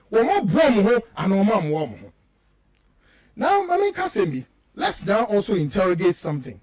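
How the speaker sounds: normal talking voice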